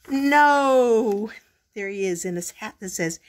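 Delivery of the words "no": loud, high, drawn-out "no"; emphatic no. A narrator's reading. Storyteller answers whether Groundhog learned, emphatically loud. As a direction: belt out one long answer.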